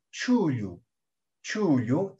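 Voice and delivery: "chuyu" with an ordinary Spanish ch; drawn-out "chuyu", ch as in Spanish; Castilian-style ch. Shown as a demonstration. Teacher contrasting it with retroflex, Spanish ch. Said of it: "este sonido existe en el castellano".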